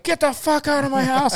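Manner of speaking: high, loud mock yell of a dazed man; angry confused patient. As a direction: shout at the medics like a confused, furious patient.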